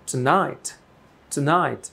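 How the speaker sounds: tonight as "t'NIGHT", vowel nearly gone; bare t leaping into NIGHT in tonight; stress on second syllable. Shown as a demonstration. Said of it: "stress হচ্ছে এখানে".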